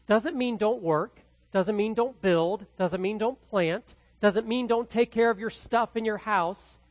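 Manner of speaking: ordinary delivery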